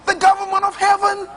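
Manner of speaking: high, slow, weighty pulpit delivery; full-force preaching. Acted. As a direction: drag out counsel Jesus for weight. Preaching on the king's commands.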